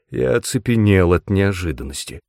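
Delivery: plain reading voice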